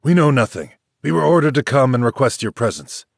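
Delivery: blunt soldiers. A narrator's reading. Generals summon Liu Bei.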